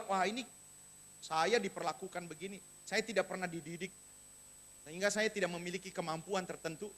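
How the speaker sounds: lightly voiced, low and soft complaint; disappointed grown child. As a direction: quietly voice a child's resentment of the parents. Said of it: "Dia akan kecewa dan marah". (keluhan anak kecewa, pelan dan rendah)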